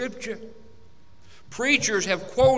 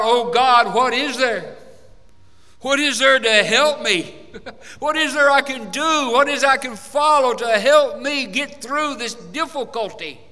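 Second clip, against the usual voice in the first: louder, pleading cry for help; crying out to God; 5 semitones higher, 5 dB louder than usual. Retold or imitated. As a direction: act out a desperate plea to God. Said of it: "you cry out".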